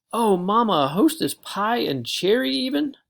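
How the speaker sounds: high, loud delight; delighted squeal. Acted. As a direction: gush over the pie. Thrilled by a treat.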